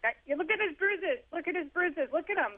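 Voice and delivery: high, bright, insistent showing-off; cheerful pointing out. Retold or imitated. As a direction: point the bruises out to the checkout girls eagerly. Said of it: "I'd be like".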